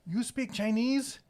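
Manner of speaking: put-on high, slow old lady voice; a lightly voiced old Chinese woman, asking slowly. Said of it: "looks at him and goes".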